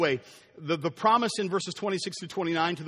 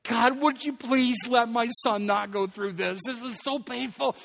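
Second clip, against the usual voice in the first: weeping prayer; 3 semitones higher, slower than usual. Retold or imitated. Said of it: "every night he wept".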